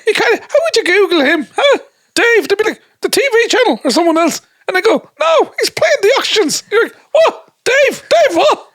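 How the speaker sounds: squeaky, very high mock exchange; comic incredulous voice. Acting out people puzzled by rapper [name], squeaky incredulous voice. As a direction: play both sides in a silly falsetto.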